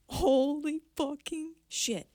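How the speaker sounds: high, drawn-out retold shock; shocked exclamation. Daughter spots her dad walking out of the kitchen, shocked. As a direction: stretch out a stunned, high-pitched oath.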